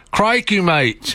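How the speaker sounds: hammed-up Australian accent. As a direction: put on a cartoonish Australian exclamation. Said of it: "Oh, Australian".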